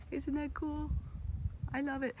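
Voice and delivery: high, soft, gushing; delighted gush. Real, not acted. Gardener showing viewers her peas, high soft delight. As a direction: squeal softly over the peas.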